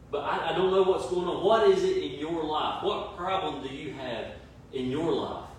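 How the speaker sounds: usual speaking voice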